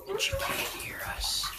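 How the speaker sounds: whispered aside. Real, not acted. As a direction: whisper it secretly. Aside so child won't hear.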